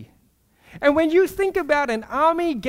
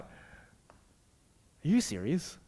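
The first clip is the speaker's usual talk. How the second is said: stunned disbelief; 8 semitones lower, 12 dB quieter, much slower than usual. Retold or imitated. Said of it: "sitting there going like".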